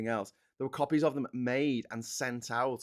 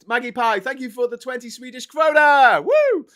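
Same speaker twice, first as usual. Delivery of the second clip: loud, high excited thank-you; excited shout; 13 semitones higher, 14 dB louder than usual. Real, not acted. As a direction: cheer the donation loudly. Thanking a donor on stream.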